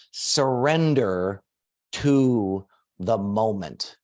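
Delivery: "surrender to the moment" drawn out slowly, word by word; slow dictation. Shown as a demonstration. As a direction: dictate it slowly so everyone can write. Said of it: "everybody write this down".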